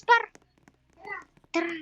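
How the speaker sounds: usual speaking voice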